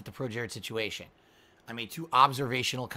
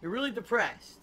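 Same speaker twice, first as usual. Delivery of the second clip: mocking; 8 semitones higher than usual. Mocking a depressed streamer.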